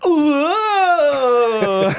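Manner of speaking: loud high cheering whoa; hammed-up high cheering whoa; crowd whoa. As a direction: whoop it like an impressed gang. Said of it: "they go".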